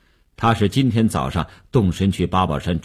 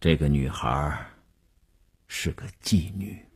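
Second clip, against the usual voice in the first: low, soft, careful disclosure; weighing the words; 4 semitones lower, 6 dB quieter than usual. A narrator's reading. Wu breaking the news to Mei, careful and hushed. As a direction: pick each phrase cautiously, voice kept down. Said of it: "斟酌了一下词句说".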